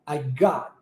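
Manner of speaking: "I got" low, without the final T; swallowed T. Shown as a demonstration. Teacher repeating for students, low "I got" with no T. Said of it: "engulo esse T".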